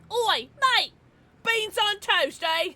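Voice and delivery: loud, high-pitched mock British shout; British accent. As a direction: holler it as a hammed-up Brit. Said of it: "such a British thing to say".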